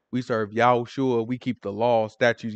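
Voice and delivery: soft, solemn mock-devout Hebrew voice; a hint of a devout Israelite; mock Hebrew piety. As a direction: lightly put on, hushed and pious. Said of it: "We talking like that".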